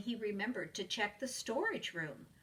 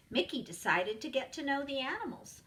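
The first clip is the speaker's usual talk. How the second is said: plain reading voice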